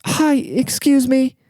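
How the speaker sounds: put-on very high-pitched British old lady; slow, squeaky-high British grandma voice; British accent. Confused lady running up at the elevator, high British accent. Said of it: "she had a British accent".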